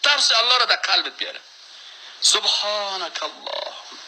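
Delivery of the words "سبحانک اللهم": drawn-out, unhurried chant of سبحانک اللهم; slow devout recitation. Shown as a demonstration. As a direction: stretch each syllable of سبحانک اللهم with reverence. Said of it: "این سبحانک اللهم بر تو خشوع بیاره". (تلاوت آهسته و با خشوع)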